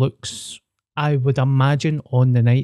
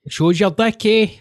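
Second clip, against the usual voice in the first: high, lilting imitation Inverness accent; playful, badly faked Inverness accent; mock Inverness accent; 8 semitones higher than usual. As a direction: mimic the mad Inverness lilt. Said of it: "mad Inverness accent".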